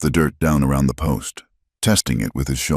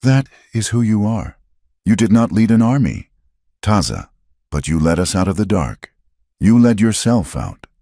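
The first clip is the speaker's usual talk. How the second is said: lightly voiced woman, higher, full of conviction; a hint of an earnest frontier widow, higher; earnest Clara; 6 semitones higher than usual. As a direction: tell him who he is, heartfelt and lifted. Clara's earnest speech to Taza.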